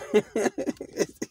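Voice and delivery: laughing at his own bit; high laugh. Real, not acted. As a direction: burst out laughing. Laughs after the mock scolding.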